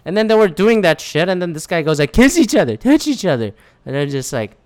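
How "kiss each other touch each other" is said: mock heckler. Retold heckler calling at wrestlers.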